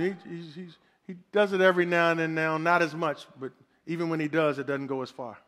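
put-on low, soft older-brother tone; a hint of a calm big brother; lightly voiced big brother. Big brother explaining to the little boy, low and soft. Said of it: "big brother".

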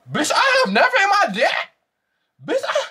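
very high, squealed exclamation; overcome with disbelief. Real, not acted. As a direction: squeal it out, too tickled to finish. Hysterical reaction to the story.

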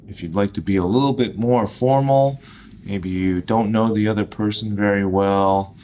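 normal talking voice

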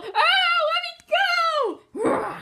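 high frightened yell; panicked shout. Acted. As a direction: struggle and shout to be freed. Struggling while being carried.

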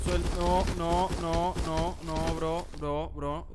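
loud, high, frantic pleading; real panic. Real, not acted. Gamer fleeing a monster chasing him, loud real panic. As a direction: beg and flee in genuine fright. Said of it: "qué remiedo que tengo".

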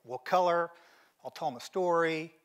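eager offer to help. Retold or imitated. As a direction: jump in keen to help. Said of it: "my reaction is".

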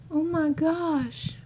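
high, slow, shaken voice. Real, not acted. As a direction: drag it out in disbelief.

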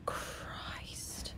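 hushed breathless whisper. Real, not acted. Player hiding from the monster, hushed whisper. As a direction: whisper so the monster won't hear.

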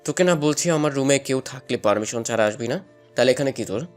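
very deep, menacing male scolding; angry big brother, low and controlled; scolding through clenched teeth. A narrator's reading. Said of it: "দাঁতে দাঁত চেপে ধমকের সুরে বলল".

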